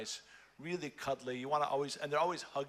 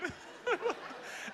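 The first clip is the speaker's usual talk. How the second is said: airy high giggle; high breathy laugh. Real, not acted. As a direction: burst into a whispery laugh. Laughing at own act.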